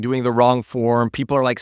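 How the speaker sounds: ordinary delivery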